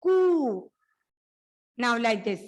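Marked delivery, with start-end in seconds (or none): 0.0-0.7 कु drawn out long and louder; stretched kuu. Shown as a demonstration. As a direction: hold the long u in कु. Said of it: "उसे हम खींचेंगे".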